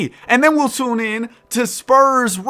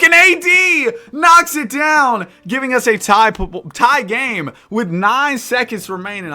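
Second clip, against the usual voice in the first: live sports announcer call; play-by-play commentary; 4 dB louder than usual. Acted. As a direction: call the game as it happens. Live play-by-play of video game.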